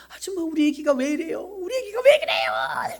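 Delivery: 겁에 질린 애원; panicked cry. Retold or imitated. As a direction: imitate a frantic young mother begging for help. Panicked young mother crying out.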